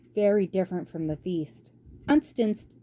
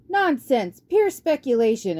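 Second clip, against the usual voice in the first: shouting; 5 semitones higher, 4 dB louder than usual. Acted. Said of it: "shouts of".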